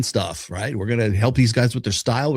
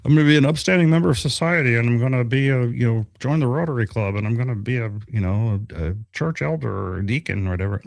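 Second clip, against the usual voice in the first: mock upstanding citizen; faster than usual. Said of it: "you could say".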